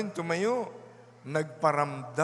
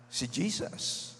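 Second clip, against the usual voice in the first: soft unvoiced whisper; a hushed whisper of recognition; 5 dB quieter than usual. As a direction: breathe the name in awe.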